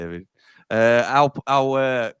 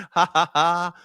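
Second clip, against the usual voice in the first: higher, mocking ha ha ha; gloating laugh; 5 semitones higher, slower than usual. Real, not acted. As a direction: laugh in the rival's face. Gloating laugh after the goal.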